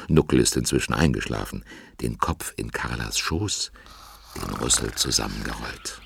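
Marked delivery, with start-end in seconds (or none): 2.0-6.0 hushed narration. A narrator's reading. Narrator, elephant child asleep.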